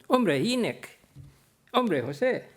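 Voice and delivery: surprised greeting. Retold or imitated. Driver and professor meet by surprise at the bus stop, delighted. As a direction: greet an old friend you never expected to meet. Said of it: "nos reconocimos mutuamente con sorpresa".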